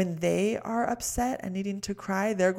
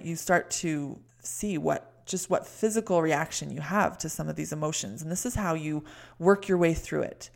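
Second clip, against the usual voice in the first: usual speaking voice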